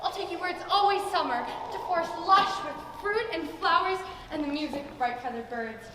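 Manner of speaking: normal talking voice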